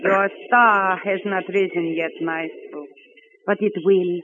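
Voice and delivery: put-on reassuring Eastern European accent. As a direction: comfort the maestro in a thick Eastern European accent. Heavy foreign accent throughout.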